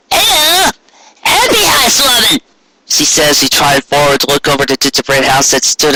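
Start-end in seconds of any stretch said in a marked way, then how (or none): 0.1-2.4 a chirpy country grandma; old Granny Smith voice. Acted. Granny Smith greets bakers.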